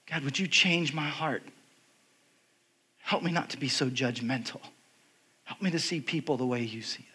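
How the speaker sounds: soft, low plea sinking toward a whisper; hushed prayer. Retold or imitated. Speaker asking God to change his heart, hushed prayer.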